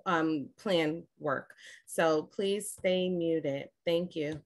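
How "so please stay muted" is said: ordinary talk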